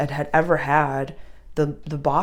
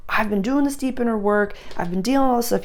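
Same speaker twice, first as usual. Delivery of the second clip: higher-pitched quoted inner voice; mock protest; 6 semitones higher, faster than usual. Astrologer voicing what the sign is thinking, mock protest. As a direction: voice their thoughts, a bit higher.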